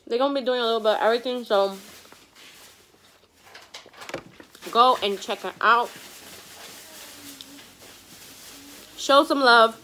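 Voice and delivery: talking while chewing food; eating during speech; mouth full. Real, not acted. Seen 3: bites taco at -5, cheeks full and chewing while talking.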